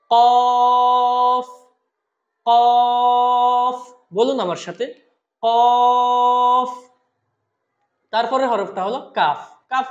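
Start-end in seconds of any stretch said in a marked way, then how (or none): 0.1-3.8 কফ loud, long, from tongue root; four-alif stretch. Shown as a demonstration. Teacher models for repetition, কফ drawn out strongly. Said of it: "আমরা চার আলিফ টানবো". 5.4-6.7 কফ loud, long, from tongue root; four-alif stretch. Shown as a demonstration. Teacher models for repetition, কফ drawn out strongly. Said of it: "আমরা চার আলিফ টানবো".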